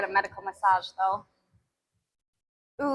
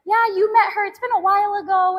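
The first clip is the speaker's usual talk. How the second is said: much higher, cheery greeting; friendly lady; 8 semitones higher, 4 dB louder than usual. Retold or imitated. The lady at the pool greets her, in a high cheery voice. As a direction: chirp it like a friendly neighbour. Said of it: "to her daughter, she's like".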